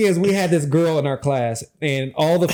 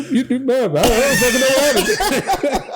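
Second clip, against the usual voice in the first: laughing disbelief; 9 semitones higher, 3 dB louder than usual. Retold or imitated.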